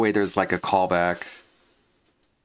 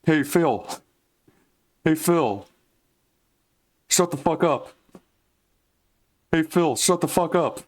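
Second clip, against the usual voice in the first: pitched-up squeezed nasal voice, now nailed; nasal John Rambo impression; 7 semitones higher than usual. Shown as a demonstration. Recording a line for an animation, the nailed nasal John Rambo. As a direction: talk as if the brain sits inside the nose. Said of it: "press my face farther and farther forward".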